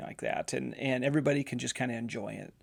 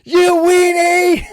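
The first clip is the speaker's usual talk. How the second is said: loud, high mocking shout across the water; teasing yell; 15 dB louder, much slower than usual. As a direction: holler an insult at a friend, laughing. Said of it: "yells at me".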